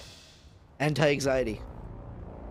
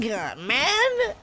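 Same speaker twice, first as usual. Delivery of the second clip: crying; 4 semitones higher, slower than usual. Real, not acted. Kid upset after losing match.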